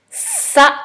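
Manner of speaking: Sa high and loud, long s; dictated syllable. Shown as a demonstration. Teacher dictating a syllable to pupil, high, emphatic Sa. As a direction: hold the s, then land Sa loudly.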